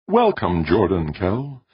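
high, slowing, serene greeting; calm, sweet tenor. A narrator's reading. Ship's mind welcomes the visitor, calm sweet tenor. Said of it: "said a calm, sweet tenor voice".

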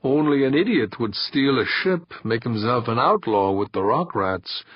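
ordinary delivery, nothing marked

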